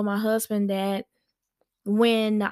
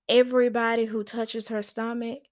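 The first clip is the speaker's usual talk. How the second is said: ordinary delivery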